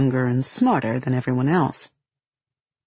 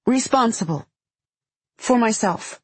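put-on earnest child; 6 semitones higher than usual. A narrator's reading. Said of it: "remembers something her brother once told her".

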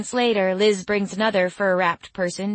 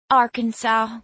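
raised Southern twang; name the place in a Southern twang; Southern accent; 5 semitones higher, 4 dB louder than usual. A narrator's reading. Girl tells where she is from, high Southern accent. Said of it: "her voice twangy with a southern accent".

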